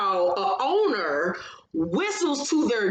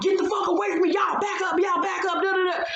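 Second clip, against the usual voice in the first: high, shouted back-off at everyone; freaking out shout; 8 semitones higher, faster than usual. Retold or imitated. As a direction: yell at them to get away. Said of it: "Brianna freaking out".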